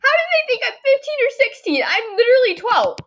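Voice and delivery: high, loud incredulous outburst; shocked disbelief. Retold or imitated. Girl retelling her reaction to being taken for sixteen, shocked. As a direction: squeal it in total disbelief.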